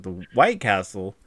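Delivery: slow, drawn-out questioning repeat; puzzled echo. Retold or imitated. Relative questioning the uncle's odd nickname, slow puzzled echo. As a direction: echo it slowly, as if baffled.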